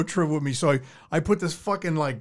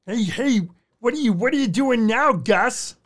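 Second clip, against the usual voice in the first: cartoon character; 7 semitones higher, 4 dB louder than usual. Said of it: "What was that cartoon?".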